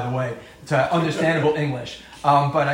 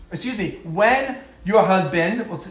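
ordinary talk, nothing marked

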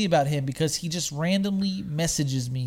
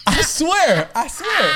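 laughing insistence; 11 semitones higher, 5 dB louder, much slower than usual. Real, not acted. Laughing while insisting story is true.

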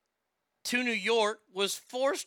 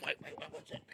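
whispered aside; 14 dB quieter, much faster than usual. Retold or imitated.

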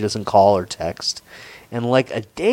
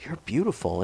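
much softer, hushed compliment; 8 dB quieter than usual. Shown as a demonstration. Softly repeating the compliment he paid the girl. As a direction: breathe it gently like a pickup line. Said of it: "I think I said it just like that".

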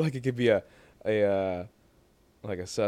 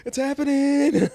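high, slow, louder mock gasp of it's happening; mock movie line; 13 semitones higher, 4 dB louder, slower than usual. Friend quoting a movie line, high and slow. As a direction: squeal it out like a movie moment.

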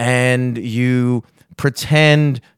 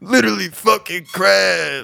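mock bro voice; 6 semitones higher than usual. Continues acted bro quote.

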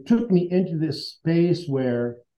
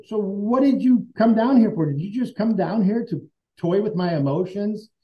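hurt demand; 6 semitones higher than usual. Retold or imitated. Confronting the woman who came back, hurt and indignant. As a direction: throw the accusation back at her.

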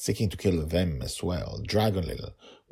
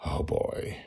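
unvoiced worried whisper. A narrator's reading. Father watches from the trees, whispered worry. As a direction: breathe it out nervously. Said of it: "Dragonfather whispered".

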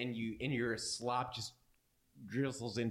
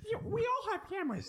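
burger dog voice. Acted. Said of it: "says the burger dog".

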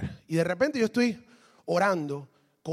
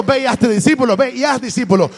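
forceful preaching; 5 semitones higher, 8 dB louder than usual. Acted. Preacher correcting misquoted command.

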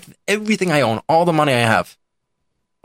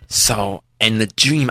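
ordinary talk, nothing marked

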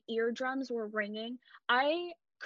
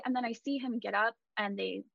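usual speaking voice

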